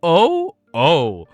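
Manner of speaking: high, overcome with feeling; getting emotional. Real, not acted. Reader moved that they got together, emotional. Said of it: "now I'm getting emotional".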